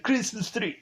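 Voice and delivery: high-pitched mock Austrian accent; Arnold Schwarzenegger. Shown as a demonstration. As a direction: put on a silly Austrian accent. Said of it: "I guess that's my Arnold accent".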